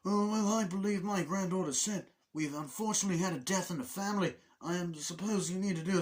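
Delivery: high put-on phone voice; hammed-up, high disguised voice; Alan Ruck. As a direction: fake a solemn caller in a high voice. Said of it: "I love the accent that Alan Ruck puts on".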